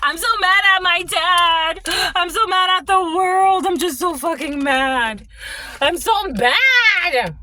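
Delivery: high, whiny fake tantrum; drawn-out mocking cry of rage; mock anger. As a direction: wail it like a hammed-up sulking brat. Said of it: "Ooh, they get so mad".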